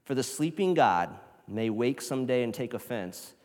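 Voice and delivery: loud, fervent reading of the quote; dramatic reading. Shown as a demonstration. Preacher reading Annie Dillard to the church, fervent and loud, ending in a whisper. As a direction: read it like a warning to the church. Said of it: "She says".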